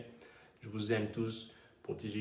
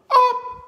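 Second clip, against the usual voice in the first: sharp, very high, loud yelp; echo test shout; 18 dB louder than usual. Shown as a demonstration. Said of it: "Vous entendez, là?".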